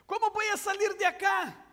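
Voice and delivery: put-on frantic child yelling for his father; child calling out. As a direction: shout for dad through the smoke. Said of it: "empieza a gritar de regreso".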